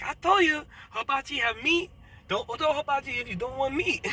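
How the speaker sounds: high-pitched, exasperated imitation; high mock quote. Lady repeating herself to the caller, high exasperated mimic. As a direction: squeak it out like the irritated lady. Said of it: "She repeat herself again".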